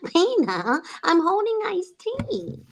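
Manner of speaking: slow, high, drawn-out Lois whine; Lois from Family Guy. Retold or imitated. A mom imitating Lois holding drinks for everyone, high and slow. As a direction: whine it like a harried sitcom wife. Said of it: "And she's like".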